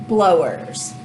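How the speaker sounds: normal talking voice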